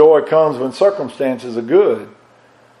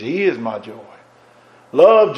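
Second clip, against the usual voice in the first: ordinary talk, nothing marked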